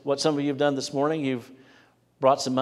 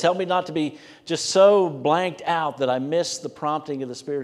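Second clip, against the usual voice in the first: earnest, louder voiced prayer that builds and then settles; pleading prayer; 4 semitones higher, 4 dB louder than usual. Preacher voicing a listener's prayer to the Lord, earnest and pleading. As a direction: pray it out as if begging not to miss the call. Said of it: "if you don't see the opportunity".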